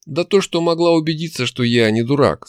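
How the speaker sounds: firm. A narrator's reading. Hero proving he is no fool, firm. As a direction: push back firmly. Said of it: "сказал я жёстко".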